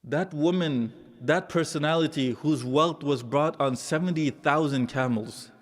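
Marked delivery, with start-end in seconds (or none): none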